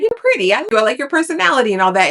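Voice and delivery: high, quick mock flirting man; flirty Xavier. Vlogger mocking Xavier hitting on the woman in the next bed, high quick flirt. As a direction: tease his clumsy pickup line, high and fast. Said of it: "he's like".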